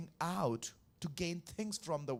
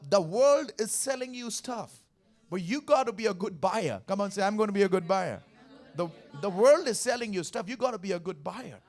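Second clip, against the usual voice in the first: loud, emphatic sermon build; preaching; 3 semitones higher, 6 dB louder than usual. Acted. Preacher urging the church to be good buyers, loud and emphatic. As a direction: preach it out to the congregation. Preacher driving home the point.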